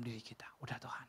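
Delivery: low, hushed whisper. Acted. Believer content with God, whispering softly. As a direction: whisper it like a quiet prayer.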